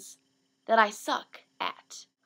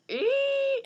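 high held "eee"; high-pitched mock panic noise; nervous squeal. Imitates nervous squeal while turning.

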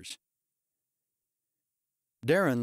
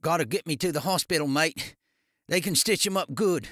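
put-on Australian accent; 5 semitones higher than usual. A narrator's reading. Wounded Darren begs for hospital.

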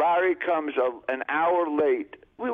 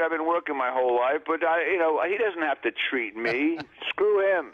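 ordinary talk, nothing marked

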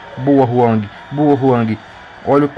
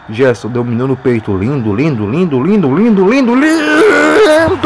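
building goal call. Real, not acted. (narração crescendo até o grito de gol)

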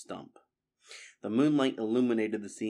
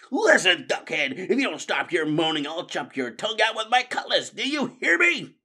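higher, much louder rat yelling; furious shout; 8 semitones higher, 7 dB louder than usual. Acted. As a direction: threaten to chop his tongue out. Redtooth threatens Fangburn.

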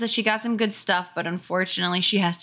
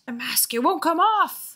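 high gravelly frightened voice; panic, shrill and rasping; 8 semitones higher than usual. Acted. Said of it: "make it be gravelly, but also shrill".

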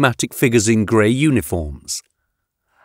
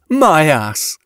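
higher-pitched dismissive scoff; derisive snort; 5 semitones higher than usual. A narrator's reading. Yatena rejects the witness's account, scornful. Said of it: "Yatena snorted".

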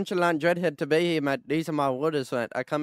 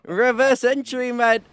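loud, high, slowed announcement; hyped stunt call; 7 semitones higher, 5 dB louder, slower than usual. Real, not acted. Gamer announcing a reverse entry stunt, loud and hyped.

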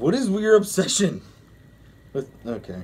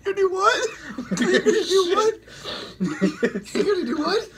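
laughing; 13 semitones higher than usual. Real, not acted. Cracking up at the story.

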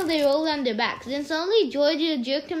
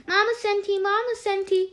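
high mocking chant of Mama Senti; singsong tease; 7 semitones higher than usual. A narrator's reading. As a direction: tease him with a sing-song. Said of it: "he teased".

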